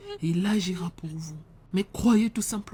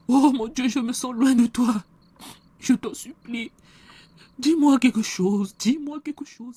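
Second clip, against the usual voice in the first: louder, desperate plea to God; desperate prayer; 3 semitones higher, 6 dB louder than usual. Acted. Pastor begs a silent God to speak, louder desperate cry. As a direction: beg God to answer, voice raised.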